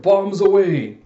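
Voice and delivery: loud, high call-out; hammed-up bombing cry; gleeful battle cry. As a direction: holler it as the bombs drop. Acted cry as bombs are thrown.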